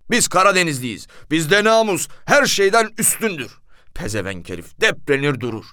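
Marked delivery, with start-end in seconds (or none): 0.1-3.6 high, loud outraged denial; indignant shout. Retold or imitated. Husband denying it to the police, outraged loud shout.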